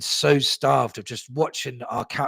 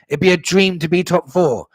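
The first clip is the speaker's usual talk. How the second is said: louder, higher mock echo; mocking repeat; 5 semitones higher, 6 dB louder than usual. Fan throwing Conte's words back at him, mocking. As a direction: throw the quote back, loud and scornful.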